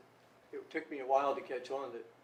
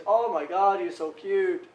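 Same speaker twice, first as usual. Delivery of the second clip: loud, higher mock gushing; imitated gushing; 6 semitones higher, 15 dB louder, slower than usual. Classroom visitors fawning over the boy, imitated gushing. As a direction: coo loudly over an adorable child. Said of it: "and they, everybody's".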